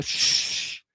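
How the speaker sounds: one long shh; imitated hush. Mocking the hush once you go in, long shush. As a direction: shush like a congregation.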